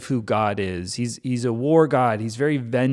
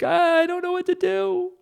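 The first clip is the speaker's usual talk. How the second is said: mock terror; 6 semitones higher, faster than usual. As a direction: squeak it out like someone scared of a violent partner. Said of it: "so violent and so aggressive".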